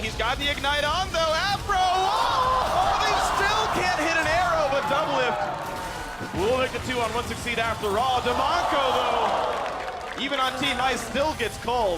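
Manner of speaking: excited play-by-play. Real, not acted. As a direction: cry out the kill as it happens. Live play-by-play climax.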